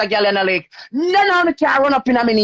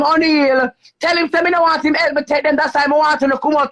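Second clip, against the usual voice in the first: usual speaking voice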